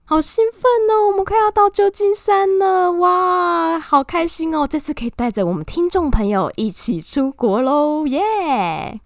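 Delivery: high, gushing excited squeal. Acted. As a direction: act thrilled about the trip. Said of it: "总是要演一下啊".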